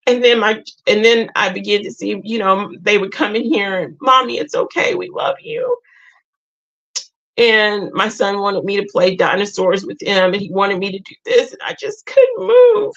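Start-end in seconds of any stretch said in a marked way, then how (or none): 4.0-5.8 put-on child's voice. Kids comforting sad mom, retold. 11.5-12.9 real tears choke the line; crying. Real, not acted. Crying while telling viewers about depression. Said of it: "I'm sorry I'm crying".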